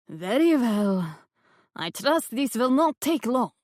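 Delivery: put-on light Russian accent for the theatrical medium. A narrator's reading. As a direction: play the fake medium with a hint of Russian. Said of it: "The Russian accent disappeared when".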